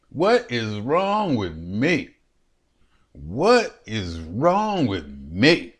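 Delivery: stretched, musing "What is wrong with me?"; slow rhetorical question. Acted. Man mocking himself for loving talk, slow musing. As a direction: draw it out, half laughing at yourself.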